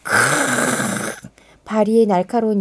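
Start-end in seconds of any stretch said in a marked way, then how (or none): 0.0-1.3 slow low beast growl. Acted. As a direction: snarl like a wolf. Wolf growls before attacking. (으르렁)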